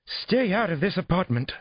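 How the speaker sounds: very high-pitched, shrieked order; near scream. A narrator's reading. Therapist orders patrolmen out of her apartment, near-screaming. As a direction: raise your voice almost to a scream. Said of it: "raised her voice close to a scream".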